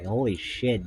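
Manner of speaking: ordinary delivery, nothing marked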